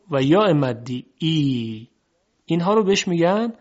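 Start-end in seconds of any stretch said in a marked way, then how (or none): 1.2-1.9 "ای" held as a long ee; long vowel. Shown as a demonstration. Teacher naming the long vowels, "ای" held long. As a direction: draw "ای" out slowly. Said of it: "صداهای کشیده".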